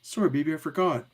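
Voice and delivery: put-on high girl voice; apologetic young girl. [name] apologises in child voice.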